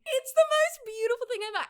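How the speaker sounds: high-pitched fangirl gush. Real, not acted. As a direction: squeal it with real delight. Gushing over the book's moment.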